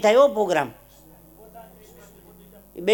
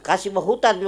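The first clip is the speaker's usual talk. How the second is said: ordinary talk, nothing marked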